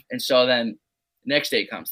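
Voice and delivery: ordinary talk, nothing marked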